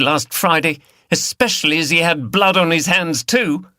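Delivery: high, loud outraged tirade; furious. A narrator's reading. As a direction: rage at them for hitting Max. Liz rages at captors.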